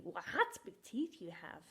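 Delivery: Wa-hat with a sounded h; Wa-hat said in two beats, then big teeth you have; silly misreading. Shown as a demonstration. Teacher playing the silly wrong reading. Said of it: "That sounds quite crazy".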